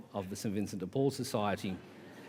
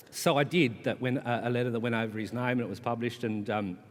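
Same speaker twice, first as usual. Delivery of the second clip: usual speaking voice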